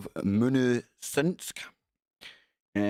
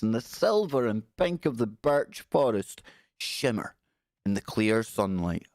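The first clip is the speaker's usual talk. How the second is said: ordinary delivery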